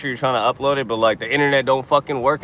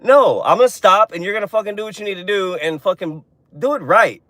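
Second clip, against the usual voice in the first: exasperated scolding; 6 semitones higher, 4 dB louder than usual. Real, not acted. Telling off absent driver.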